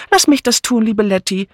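put-on high, fussy old lady eagerly offering; eager call. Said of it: "rief Miss Banner". (hoher, eifriger Ruf einer aufgeregten alten Dame)

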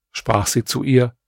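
usual speaking voice

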